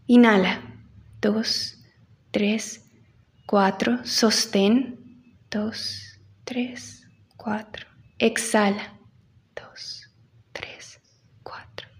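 counting breaths, fading to whisper; slow breath counting. Shown as a demonstration. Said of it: "leads breathing exercise".

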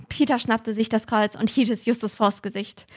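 ordinary delivery

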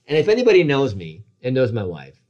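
ordinary talk, nothing marked

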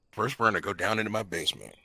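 low, soft, quick sultry purr; a hint of the smooth sultry host. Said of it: "Mister Sultry Voice".

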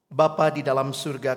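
usual speaking voice

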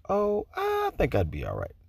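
unconcerned student. Retold or imitated. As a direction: imitate a student casually brushing off the warning. Student's breezy answer to warning, retold.

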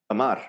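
"amar" as quick uh-MAR; English schwa. Shown as a demonstration. Teacher shows the English habit, "amar" with schwa at the start. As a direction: reduce the first vowel of "amar" to uh. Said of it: "in English, we would just go".

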